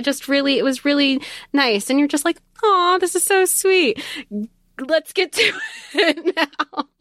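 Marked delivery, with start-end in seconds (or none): 2.6-6.9 high, slow, dreamy gush that breaks into a laugh; swoon, then giggle at your own eagerness; gushing over a sweet scene. Pretend. Fan reading a sweet scene, swooning with a giggle.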